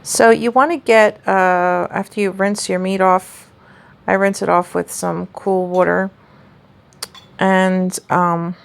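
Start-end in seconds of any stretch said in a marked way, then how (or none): 5.3-6.1 Jersey "water", said "wawter"; Jersey accent. Real, not acted. Cook telling viewers how she rinsed the meat, Jersey accent. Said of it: "sorry, that was the Jersey accent".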